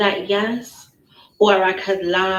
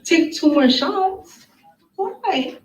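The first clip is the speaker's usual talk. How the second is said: high, slow disbelieving echo; stunned question that shoots up; shocked; 8 semitones higher, much slower than usual. Real, not acted. Shocked echo of his words.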